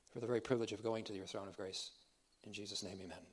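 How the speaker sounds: soft, hushed prayer to God; closing a prayer softly before the lesson. Real, not acted. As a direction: pray it quietly and reverently.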